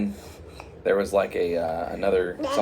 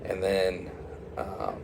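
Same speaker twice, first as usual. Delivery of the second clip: usual speaking voice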